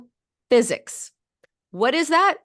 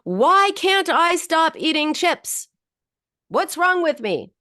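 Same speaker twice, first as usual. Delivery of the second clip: higher, slow, desperate questions; distressed plea; 8 semitones higher, slower than usual. Retold or imitated. Client Beth pleading with her therapist, distressed and higher. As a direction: ask it like you're at your wit's end. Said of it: "who came in and said".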